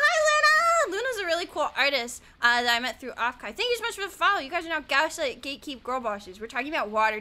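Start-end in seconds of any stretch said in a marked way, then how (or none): 0.0-1.0 high, loud squeal of hello; excited greeting. Real, not acted. Greeting a viewer who just arrived.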